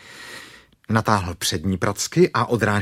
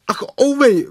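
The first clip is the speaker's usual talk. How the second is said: high, loud ouch; cry of pain; 7 dB louder than usual. Acted. Bear being pulled by the paw, high pained cry. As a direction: yelp it out, very high. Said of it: "vykřikl Pů".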